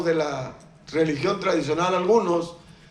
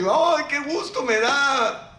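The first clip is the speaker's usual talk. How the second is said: mock delight; 7 semitones higher, slower than usual. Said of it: "Tú dices".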